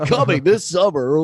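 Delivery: movie trailer voice. Acted. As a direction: announce the summer blockbuster. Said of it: "I sound like a movie trailer".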